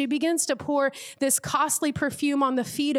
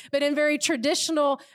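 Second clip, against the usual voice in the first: ordinary talk, nothing marked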